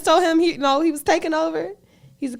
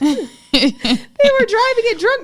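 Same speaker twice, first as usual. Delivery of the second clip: high, slow, amazed; excited disbelief; 10 semitones higher, 6 dB louder, slower than usual. Real, not acted. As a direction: stretch it out in disbelief. Incredulous high slow telling.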